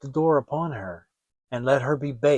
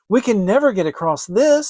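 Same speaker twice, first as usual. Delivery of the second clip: worried; 5 semitones higher, 5 dB louder than usual. Acted. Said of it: "said Hansel".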